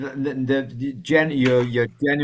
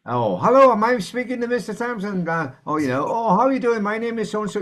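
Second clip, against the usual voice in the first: much higher, sugary scripted sales voice; fake-friendly telemarketer; 10 semitones higher, 4 dB louder than usual. Man imitating a cold caller, much higher mock sales pitch. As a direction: put on a chirpy sales pitch. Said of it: "you can tell immediately".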